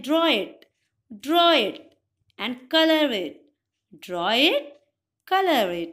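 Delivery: loud, punchy draw it commands; loud teacher command. Shown as a demonstration. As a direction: bark out the drawing task. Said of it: "तो वो क्या बोलते हैं". (ज़ोर से दिया गया आदेश)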